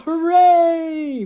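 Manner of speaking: high, loud hooray; cheering yell. Acted. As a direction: cheer it out big.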